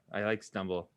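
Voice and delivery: ordinary talk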